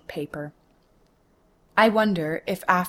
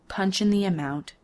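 ordinary delivery

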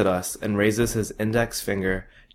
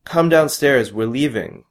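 louder, higher call that settles; 5 semitones higher, 6 dB louder than usual. A narrator's reading. Mom telling her son to come down, calling. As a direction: call him down firmly.

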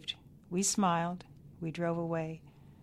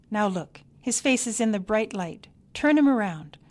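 louder, higher fussy order; bossy snap; 3 semitones higher, 7 dB louder than usual. A narrator's reading. Grandmother orders the baby turned from light, bossy snap. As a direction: bark the order at her. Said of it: "Miney snapped".

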